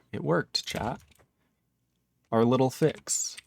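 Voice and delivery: usual speaking voice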